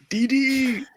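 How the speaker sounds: high, exasperated mock call; cartoon character cry. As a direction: call out like an annoyed boy genius. Imitating Dexter calling his sister.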